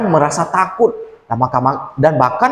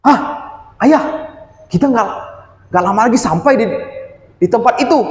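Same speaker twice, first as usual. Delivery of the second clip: put-on a touch higher, eager little boy pointing ahead; excited child spotting the place far off; lightly voiced child; 7 semitones higher than usual. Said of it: "kata si Luka dari jauh". (suara anak kecil yang bersemangat, sedikit lebih tinggi)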